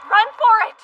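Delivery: shout. Acted. Fleeing monsters.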